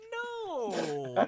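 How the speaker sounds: high, loud, drawn-out no; Jenny laughing it off. Acted. Woman asked for free food, loud high amused refusal. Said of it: "And she goes".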